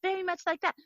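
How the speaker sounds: put-on very high, soft girlish voice; tiny high demure voice; childlike, meek Japanese woman. Shown as a demonstration. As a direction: imitate a non-threatening, childlike woman. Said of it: "childlike and very non-threatening".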